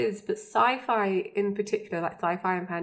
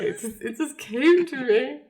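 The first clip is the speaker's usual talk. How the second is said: Chuck; 5 semitones higher than usual. Retold or imitated. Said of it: "looks at them and he's like".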